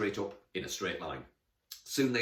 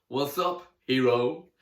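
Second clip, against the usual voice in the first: goofy goat voice; 5 semitones higher, 9 dB louder, slower than usual. A narrator's reading. Goat greets Emily.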